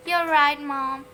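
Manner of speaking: put-on sugary sweet voice of a perfect boy; goody-goody child. A narrator's reading. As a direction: sound like a teacher's pet. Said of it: "said pure perfect Peter".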